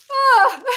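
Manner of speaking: high catching laugh; a laugh breaking into tears; teary laugh. Real, not acted. Choked-up laugh while signing off.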